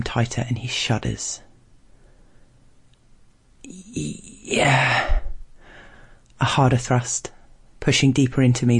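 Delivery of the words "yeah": broken, drawn-out moan; climax moan. A narrator's reading. Narrator voicing the shuddering stranger, climax moan. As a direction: stutter into a long falling groan.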